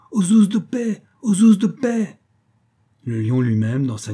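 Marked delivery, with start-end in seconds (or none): 0.1-1.0 high, loud cry for the police; old shoemaker crying out. A narrator's reading. Old shoemaker yells from his shop for the police, high cry. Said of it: "Un vieux cordonnier juif criait". 1.2-2.2 high, loud cry, "ouzes" falling at the end; repeated cry for help. A narrator's reading. Shoemaker keeps yelling for the police, falling cry. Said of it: "Un vieux cordonnier juif criait".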